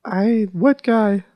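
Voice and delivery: high-pitched mom voice on the phone. Pretend.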